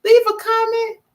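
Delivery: mock panicked inner voice; imitating her own panic. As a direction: act out the sudden worry of a forgotten comment. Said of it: "And I'm like".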